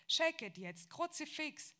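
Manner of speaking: loud, high-pitched scolding of the dogs; mother shouting at dogs. A narrator's reading. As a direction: bark it at the barking dogs. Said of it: "hatte meine Mutter gerufen". (laut und hoch rufend, schimpft mit den Hunden)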